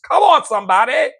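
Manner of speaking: high, loud church call-out; preacher's call. Real, not acted. Rousing his listeners like a preacher, high and loud. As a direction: rouse the congregation.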